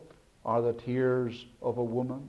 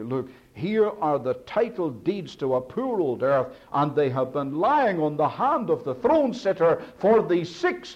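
rising, much higher and louder plea, pressing on; impassioned John; 6 semitones higher, 5 dB louder than usual. Acted. Preacher voicing John to the congregation, a rising impassioned plea. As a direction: let John's distress build to a loud, high cry. Preacher voices John's lament.

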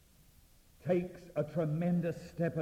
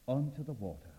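ordinary delivery

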